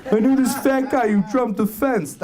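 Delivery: mocking heckler. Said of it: "calling me out".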